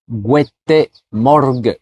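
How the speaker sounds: "gute Morgen" lent, "gwété morg" sans le N final; suisse allemand. Shown as a demonstration. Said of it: "une autre façon de le dire". ("gute Morgen" très lent, dit "gwété morg")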